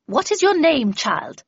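loud, stern demand, starting high. A narrator's reading. Miss Havisham questions the stranger from the shadows, stern. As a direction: question a child with stern authority. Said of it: "she asked sternly".

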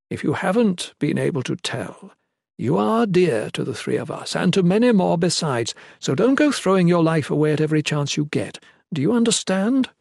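grave but animated warning; serious. A narrator's reading. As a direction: press it home like a concerned elder. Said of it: "The First Mother's tone grew serious".

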